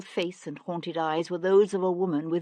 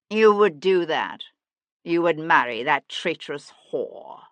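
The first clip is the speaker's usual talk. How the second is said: contemptuous sneer; 7 dB louder than usual. A narrator's reading. Jarvis sneers at Devlin.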